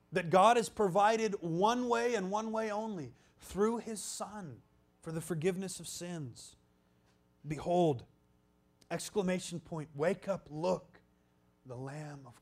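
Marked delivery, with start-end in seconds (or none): none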